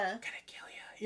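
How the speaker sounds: unvoiced whispered threat; very soft ghostly whisper. Shown as a demonstration. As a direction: whisper a creepy threat in their ear.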